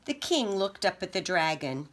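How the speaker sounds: plain reading voice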